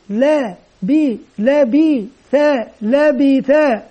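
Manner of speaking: "لا", "بي", "ثا" slow and evenly held, built up cumulatively; spelling aloud together. Shown as a demonstration. Teacher invites children to say it together, "لا بي ثا" in slow, even syllables. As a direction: lead a syllable drill on "لا بي ثا", piece by piece. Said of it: "ننطق سويا".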